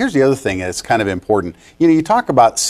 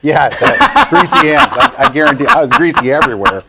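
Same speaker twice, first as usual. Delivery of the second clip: laughing; 3 semitones higher, 5 dB louder than usual. Real, not acted. Laughing about oil rig days.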